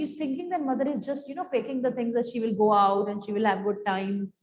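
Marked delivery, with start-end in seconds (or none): none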